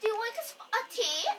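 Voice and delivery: put-on British accent. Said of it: "speak in our British accent".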